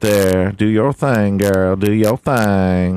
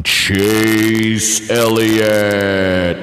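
announcer name call; 4 semitones higher, much slower than usual. Acted. Calling out the race winner, stretched announcer drawl. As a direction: Chase Elliott stretched out very slowly, announcer style.

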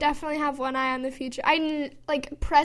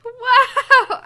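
loud high amazed wow; excited; 10 semitones higher, 13 dB louder than usual. Real, not acted. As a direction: burst out in delight. Reacts to horoscope fitting.

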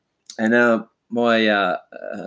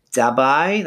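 Dubbo with a higher, rising last syllable, like "Dub-bye"; Dubbo accent; 5 semitones higher, 3 dB louder than usual. Shown as a demonstration. Man imitating how his family's town talks, Dubbo accent. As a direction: rural New South Wales lilt lifting the end of Dubbo. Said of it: "they kinda talk like this".

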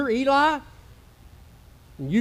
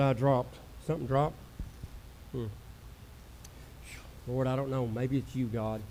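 low, soft, spent murmur to God; quiet breathless aside; 7 semitones lower, 8 dB quieter than usual. Real, not acted. Pastor out of breath, quietly asking God for help to move on. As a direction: catch your breath and pray under it.

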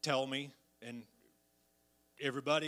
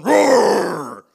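big shouted roar, much louder; loud lion roar imitation; mock lion roar; 3 semitones higher, 19 dB louder than usual. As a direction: roar from behind to scare. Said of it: "They're back there going".

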